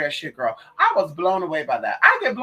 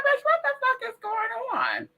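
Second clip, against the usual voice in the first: much higher, incredulous squeal; shocked disbelief. Retold or imitated. Retelling her reaction to cousins' messages, high shocked squeal.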